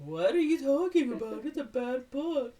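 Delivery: high, mocking scoff at the book; mocking prude. Townsperson dismissing a classic, high scornful mock. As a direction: sneer it like an outraged local.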